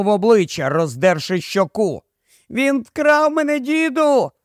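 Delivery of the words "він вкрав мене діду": put-on very high, thin child's cry; small boy's piping voice; thin birdlike call. A narrator's reading. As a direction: call out high like a little bird. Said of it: "тонко, майже по-пташиному гукав малюк".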